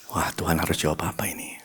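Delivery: hushed, helpless whisper to God; whispered despair. Retold or imitated. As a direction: breathe it out, at a loss. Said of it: "Dan saya bilang".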